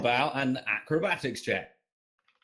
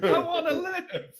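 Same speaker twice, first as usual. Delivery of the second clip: laughing mock plea. Player begs for character.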